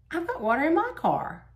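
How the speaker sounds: high-pitched emphatic comeback; pointed, high reply in a retold exchange; retold retort. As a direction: turn down the offer, voice jumping high. Her retort to the retold offer.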